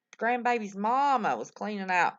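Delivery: usual speaking voice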